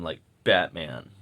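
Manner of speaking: low, slow odd take on the word Batman; odd low drawn-out Batman. Real, not acted. Rambling vlogger oddly stretches out Batman, low and slow. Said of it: "I don't know why I said it like that".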